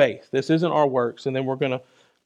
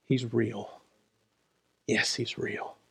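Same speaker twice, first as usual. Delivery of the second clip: much softer, intimate; hushed testimony; 7 semitones lower, 13 dB quieter than usual. Real, not acted. Preacher testifying to the congregation, hushed. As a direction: say it close, barely above a breath.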